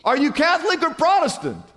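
high-pitched acted question; hammed-up eager passerby. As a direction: press on excitedly for common ground.

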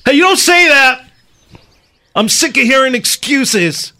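high, heated acted outburst; angry son. Acted quarrel with mother.

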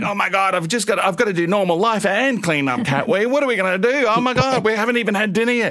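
high, frantic, rushing exclamations; acting out flustered panic over the cat mess; mock panic. Parent retelling the cat mess to the podcast guests, in high mock panic.

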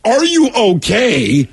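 high, slow, stretched-out check-in; retold alarmed concern. Husband to wife who jumped from the car, mock alarmed concern. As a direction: act out a worried husband, drawing each word out.

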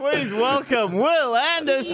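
slow, bright guest welcome; announcer. Acted. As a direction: present him like a host. Introducing the guest.

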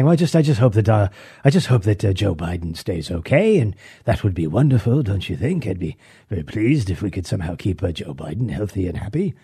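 lightly mock a smug British actor, fading to a hush; mocking British guest. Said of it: "by saying".

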